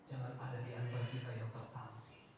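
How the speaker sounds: hushed whisper; whispered warning. Acted. Solemn warning to congregation.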